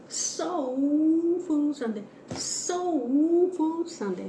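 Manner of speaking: high, slow sing-song title call; drawn-out show callout. Acted. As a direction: stretch it out like a show host.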